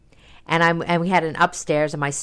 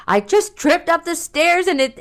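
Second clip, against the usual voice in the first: mock sob story, pitched way up; retold crying; 12 semitones higher, 3 dB louder than usual. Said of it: "and I'm crying".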